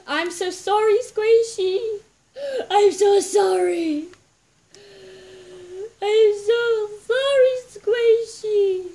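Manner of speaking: high, loud crying sorry; real mock-tearful loud wail; wailing apology. Real, not acted. Apologizes to pet loudly.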